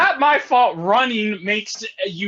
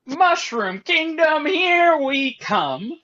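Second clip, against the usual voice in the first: put-on Brooklyn Italian accent; 10 semitones higher, much slower than usual. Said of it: "was my Brooklyn Italian accent spot on".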